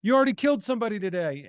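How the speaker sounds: high-pitched, hurried imitation of a plea; urgent mock plea. Acted plea to stop shooter.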